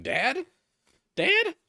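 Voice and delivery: high, loud calling Dad?; mock game character. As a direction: call out like a lost game character. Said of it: "various characters in this game just going".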